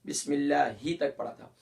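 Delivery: ordinary talk, nothing marked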